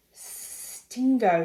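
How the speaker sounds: lizard hiss on a drawn-out S. A narrator's reading. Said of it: "he hissed".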